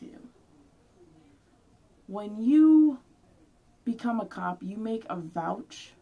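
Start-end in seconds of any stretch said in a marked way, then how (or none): 2.1-4.6 sudden yell, much louder and higher; outburst. Real, not acted. Ranting at the officer who killed him, yelling. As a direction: explode on the first words. Raging rant at the police.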